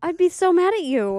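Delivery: mock outrage. Real, not acted. Wife protests his escape fantasy.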